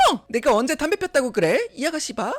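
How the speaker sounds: high-pitched mock outrage; indignant denial. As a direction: act scandalized at the accusation. Sister-in-law denies smoking. (어이없다는 듯 발끈하며)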